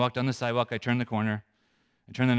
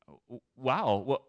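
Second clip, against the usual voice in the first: softly stammered, grateful astonishment; taken aback; 4 dB quieter, slower than usual. Retold or imitated. Saleswoman answers an unexpected apology, taken aback. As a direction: stammer in surprise, then thank them softly. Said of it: "she was totally taken off guard".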